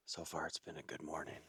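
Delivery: soft whispered field update; wildlife watching whisper. Real, not acted. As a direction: whisper quietly to the camera. Whispered update while stalking.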